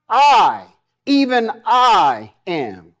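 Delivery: emphatic "I," and a slow "even I am"; stress on I. Shown as a demonstration. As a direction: hit "I," then draw out "even I am". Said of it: "the emphasis is on the I".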